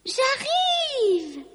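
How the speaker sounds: high sing-song call; high-pitched holler down a hole. A narrator's reading. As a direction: call that you are on the way, stretched and high. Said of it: "cria-t-elle".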